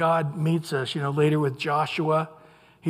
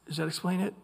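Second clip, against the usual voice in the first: ordinary talk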